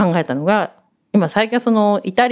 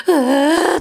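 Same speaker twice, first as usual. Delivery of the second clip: a higher, tense moan of fear; high scared whimper; 10 semitones higher than usual. Retold or imitated. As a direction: whimper as the dinosaur comes. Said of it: "とか言ってて". (高い声で怖がってうめく)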